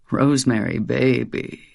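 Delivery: a low croak; lightly croaked low demon. Acted. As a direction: croak her name like a monster. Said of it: "he croaked".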